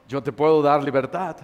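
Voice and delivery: low, soft, steady reassurance; calm voice of Jesus. Acted. Jesus answering the pleading sinner: low soft reassurance. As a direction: answer gently from above, unhurried.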